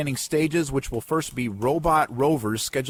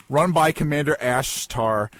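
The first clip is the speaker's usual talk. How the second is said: ordinary delivery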